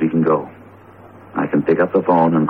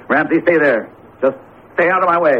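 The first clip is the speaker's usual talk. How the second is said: higher and fast, pushing past him; tense warning; 8 semitones higher than usual. Acted. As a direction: hurry him aside, nerves on edge. Frank confronts possessed Ramsey.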